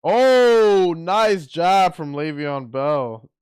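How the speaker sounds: loud, high drawn-out "oh"; excited reaction. Real, not acted. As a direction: burst out as the punch lands. Reacts live to a punch landing.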